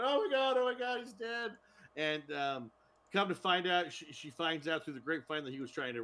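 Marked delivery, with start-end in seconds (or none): 0.0-1.5 mock crying wife. Widow finds her husband dead, hammed-up high crying wail. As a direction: ham up the widow's sobbing cry. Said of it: "she's crying, going".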